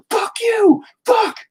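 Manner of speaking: mock tantrum in a very high screech; mad at self. Painter imitating their own cursing at the painting, mock-angry screech. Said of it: "I get so mad at myself".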